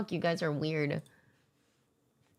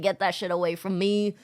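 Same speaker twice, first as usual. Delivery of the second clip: usual speaking voice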